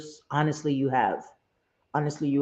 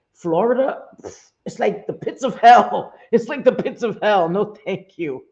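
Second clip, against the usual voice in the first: louder, higher, laughing disgust; mock horror; 5 semitones higher, 7 dB louder than usual. Real, not acted. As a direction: scoff at the heat as if it were hell. Mocking Florida's summer heat.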